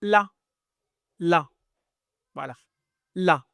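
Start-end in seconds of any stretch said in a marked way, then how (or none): 0.0-1.5 "la" said clearly and a bit higher; syllable demo. Shown as a demonstration. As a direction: model the syllable "la" for the class. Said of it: "se prononce".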